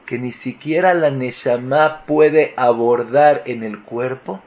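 usual speaking voice

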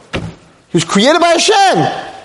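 very high-pitched exasperated plea; impassioned protest. Real, not acted. Preacher asking Hashem what he expects, impassioned protest. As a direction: plead helplessly at the top of the range.

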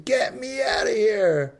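high, drawn-out cry from a pit; screaming for help. Acted. Man fallen in a pit calls for rescue, screaming. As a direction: yell desperately up from far down. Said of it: "As long as you hear him screaming".

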